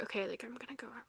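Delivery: half-whispered, very soft retelling; hushed quote. Retold or imitated. Finally telling Noah she's gonna go out, hushed. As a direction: drop to a quick, near-voiceless murmur. Retold quiet announcement after crying.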